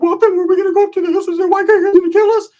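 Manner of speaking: very high, loud squeal; hammed-up panic. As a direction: squeak it out in mock terror. Frightened Ricky panics about the trip.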